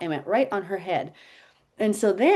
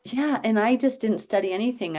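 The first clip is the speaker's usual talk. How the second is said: normal talking voice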